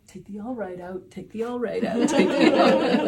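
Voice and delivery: a comic escalating self-nag, breaking toward laughter; mounting exasperation. Retold or imitated. Retold mantra while editing videos.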